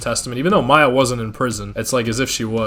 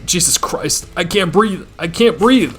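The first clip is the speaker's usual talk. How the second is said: panicked gasping; 7 semitones higher, slower than usual. Acted. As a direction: fight for breath as the panic hits. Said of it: "Barely managing to get the words out".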